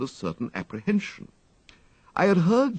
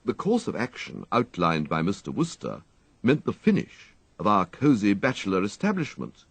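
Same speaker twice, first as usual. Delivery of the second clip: ordinary delivery, nothing marked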